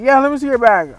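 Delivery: high, loud order; demanding shout. Retold or imitated. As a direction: bark it like a suspicious clerk. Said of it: "He's like".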